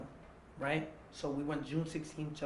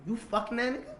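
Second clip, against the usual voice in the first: higher, rising challenge; accusing question; 7 semitones higher than usual. Retold or imitated. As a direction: put it to them as a pointed question. Retold confrontation, accusing question.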